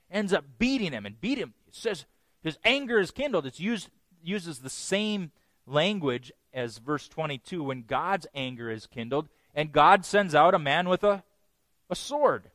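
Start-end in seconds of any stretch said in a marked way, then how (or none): none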